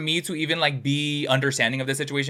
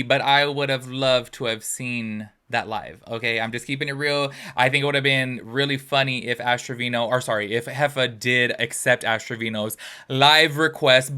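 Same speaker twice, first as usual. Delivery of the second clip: ordinary talk, nothing marked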